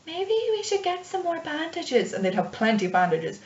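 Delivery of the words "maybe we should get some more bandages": high, slow voice for a timid character; high-pitched timid cleric; timid healer. Acted. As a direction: suggest it meekly, slow and high. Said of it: "She'd just be like".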